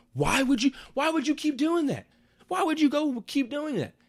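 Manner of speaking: high-pitched incredulous questioning; exasperated scolding. Acted. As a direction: squeal it in disbelief at her choice. Mock scolding of the character.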